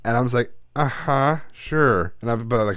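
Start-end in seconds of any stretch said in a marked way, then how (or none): 0.8-2.1 retold smart-ass brush-off; sarcastic fake agreement, acted out; be a smart-ass about it. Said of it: "real smart ass about it".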